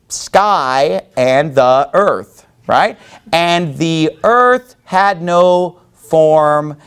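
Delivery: very slow, grand mock Bible reading; mock solemn scripture reading. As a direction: drag it out like a pompous reading of Genesis. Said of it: "instead of".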